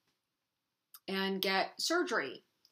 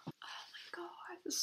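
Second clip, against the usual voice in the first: tearful breathy whisper; whispering through tears; crying whisper; 9 semitones higher, 16 dB quieter than usual. Real, not acted. To her viewers about her cancer, whispering through tears. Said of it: "Why am I crying?".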